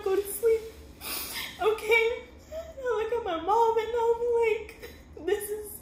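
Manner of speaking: high, tearful voice breaking into sobs; real crying. Real, not acted. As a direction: talk on through real tears, pitch climbing high. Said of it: "I'm gonna start crying".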